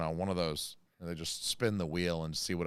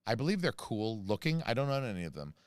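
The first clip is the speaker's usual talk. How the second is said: ordinary talk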